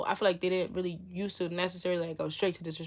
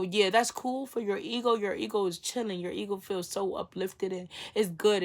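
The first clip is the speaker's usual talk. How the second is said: ordinary talk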